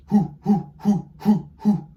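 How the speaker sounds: high, loud owl hoots; breathy owl hoo from the diaphragm. Shown as a demonstration. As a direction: hoot like Papa Owl on the breath. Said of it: "like this".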